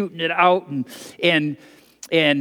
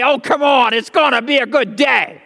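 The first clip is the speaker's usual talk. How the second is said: breezy cheer; 6 semitones higher, 4 dB louder than usual. Retold or imitated. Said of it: "you'd think he'd say".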